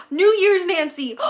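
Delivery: loud, slow delighted exclamation; excited gasp. Real, not acted. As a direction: gasp and cry it out with joy. Excited gasp at nostalgic episode.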